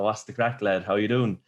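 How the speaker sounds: usual speaking voice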